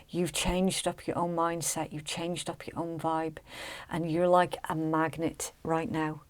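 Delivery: ordinary talk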